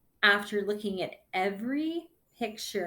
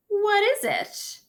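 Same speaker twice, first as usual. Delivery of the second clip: bright, much higher and louder "What is it?"; eager child question; 13 semitones higher, 7 dB louder than usual. Acted. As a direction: ask it bursting to know the answer. Nico asks Iris eagerly.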